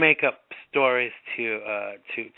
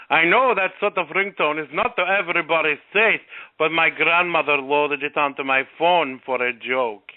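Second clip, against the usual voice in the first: put-on Russian accent. Host voices the Russian fisherman explaining his ringtone, light Russian accent. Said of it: "That was my Russian accent".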